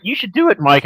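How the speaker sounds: high, coaxing imitation of her friend; mock urging friend. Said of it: "and she's like".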